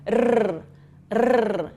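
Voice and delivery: sustained tongue-tip trills, one after another; rolled R trill. Shown as a demonstration. Teacher drilling the strong R, sustained trills. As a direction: roll two separate Rs, tongue vibrating. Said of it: "Vibra bem".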